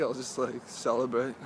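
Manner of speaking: ordinary talk, nothing marked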